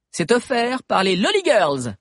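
put-on bright teen girl voice; girl character voice. A narrator's reading. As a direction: voice a chatty teenage girl. Sabi's girlish character voice.

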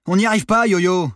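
high, loud call across water; calling for help. A narrator's reading. Stranded sailor to the monitor, calling out. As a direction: holler that you are stuck.

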